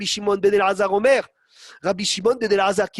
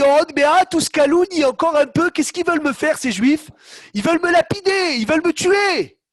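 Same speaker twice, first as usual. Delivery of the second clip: high, loud, complaining outburst; exasperated Moshe; 7 semitones higher, 4 dB louder than usual. Retold or imitated. Moshe complains about the people who want to stone him, exasperated. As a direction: vent like a leader fed up with his people. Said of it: "Qu'est-ce qu'il a dit, Moshe Rabbeinu?".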